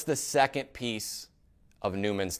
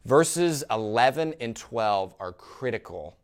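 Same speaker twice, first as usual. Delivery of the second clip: usual speaking voice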